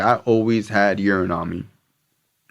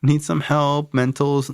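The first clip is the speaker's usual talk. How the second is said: higher, hesitant officer voice; probation lady; 8 semitones higher than usual. Retold or imitated. Probation officer suggesting he needs help, in a higher hesitant voice. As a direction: play the unsure tester, pitched up. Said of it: "this lady would be like".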